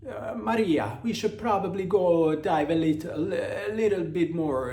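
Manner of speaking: high, loud hammed-up Italian accent; mock Italian accent. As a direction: play an excitable Italian fella with a thick accent. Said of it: "an Italian fella is like".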